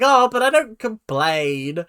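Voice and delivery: slow mock-innocent whine; mock contestant. Vlogger mocking a crying contestant, slow mock-innocent. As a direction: imitate her claiming she never complains. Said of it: "you're like".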